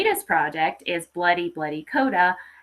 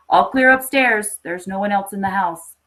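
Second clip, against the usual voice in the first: officer calling out; 8 dB louder than usual. Acted. Officer calls out from house.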